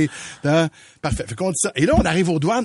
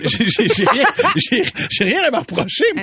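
laughing protest; 8 semitones higher than usual. Retold or imitated. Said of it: "Fait que j'ai dit".

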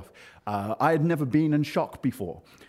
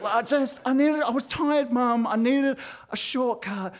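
high, halting plea to mum; whiny excuse; 11 semitones higher than usual. Retold or imitated. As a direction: act out a kid wheedling out of trouble, pitched up. Pleading excuse to angry mum.

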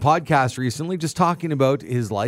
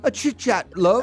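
British accent impression; 5 semitones higher than usual. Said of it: "We're having an impression off".

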